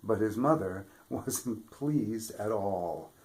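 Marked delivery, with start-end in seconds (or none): none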